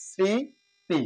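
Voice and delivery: স্মৃতি said "sriti" with a nasal touch and no m; nasalized sriti. Shown as a demonstration. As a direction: put a light nasal on স্মৃতি. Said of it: "এটা হচ্ছে সঠিক উচ্চারণ".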